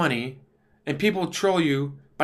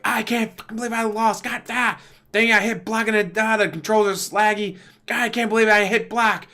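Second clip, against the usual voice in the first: higher, hammed-up rage after losing a match; mock gamer rage; 5 semitones higher, 3 dB louder than usual. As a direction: play a gamer fuming over a lost match.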